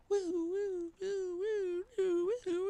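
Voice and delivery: faint, high wailing siren imitation; high, soft "woo" siren; distant siren. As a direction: wail softly like a siren far down the road. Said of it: "I'm hearing in the distance".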